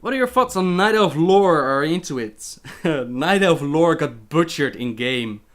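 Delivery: normal talking voice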